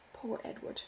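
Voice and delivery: soft whispered pity; hushed mock pity; whispered aside. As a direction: breathe it as a quick private aside. Aside within Robert's speech.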